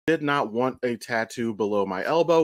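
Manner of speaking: normal talking voice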